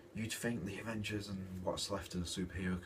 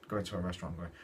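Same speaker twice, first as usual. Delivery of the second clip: ordinary delivery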